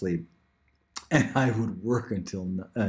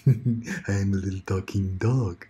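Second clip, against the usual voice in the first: talking dog. Acted. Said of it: "a talking dog maybe would be good".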